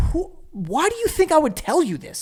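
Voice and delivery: incredulous retort. Pretend. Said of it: "And you're like".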